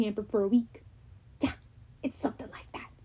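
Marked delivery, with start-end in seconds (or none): 1.4-2.9 whispered aside. Acted. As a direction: whisper it to the listener. Aside to the listener.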